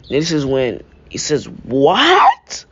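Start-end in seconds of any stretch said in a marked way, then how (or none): 1.5-2.6 a sudden high, loud "What?"; shocked. Acted. Son hearing his father is alive, shocked high cry. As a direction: jolt up into disbelief.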